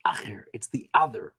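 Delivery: whispered aside. Real, not acted. Teacher whispering to students that Acher is the other. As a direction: hush it like a secret.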